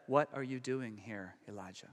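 very soft, low voice of God; still small voice. Retold or imitated. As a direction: let it sink almost to nothing. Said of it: "Here's the still small voice".

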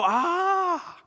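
high, drawn-out "ah" of revelation; dawning-realization gasp. Acted. Disciples as their understanding opens, awed aah. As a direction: let out a long, high aah. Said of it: "They go".

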